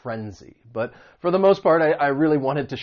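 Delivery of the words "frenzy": "frenzy" with the weight on "fren"; stress on first syllable. Shown as a demonstration. Teacher modeling the word, stress on "fren". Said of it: "The stress is on that first syllable".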